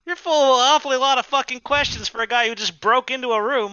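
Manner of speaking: high, sneering rebuke; mock the intruder; snarky squatter. NPC scolds player.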